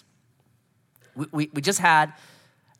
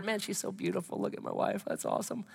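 whispered to himself in awe; hushed admiring whisper; 3 semitones higher, 12 dB quieter than usual. Pretend. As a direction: breathe it out softly, admiring her from outside. Admiring wife through glass.

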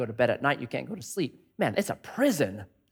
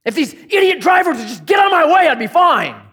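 much higher, louder mock yell at drivers; road rage shout; 11 semitones higher, 7 dB louder than usual. Preacher playing an angry driver, hammed-up shout. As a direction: bellow at the idiot drivers.

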